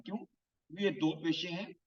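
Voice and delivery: usual speaking voice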